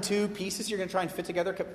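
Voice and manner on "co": usual speaking voice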